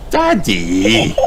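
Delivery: put-on childlike exclamation into high giggles; giggling. A narrator's reading. Peppa teases Daddy about his spoonful of porridge, giggling. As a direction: scold Daddy playfully, then giggle. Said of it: "Peppa giggled".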